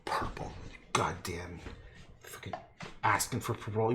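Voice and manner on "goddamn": ordinary talk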